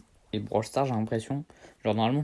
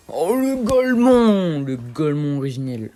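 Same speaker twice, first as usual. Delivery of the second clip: loud, high, slow exclamation; excited shout; 5 semitones higher, 11 dB louder, slower than usual. Real, not acted. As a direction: cry out in delight. Réagit au jeu. (exclamation enthousiaste, forte et aiguë)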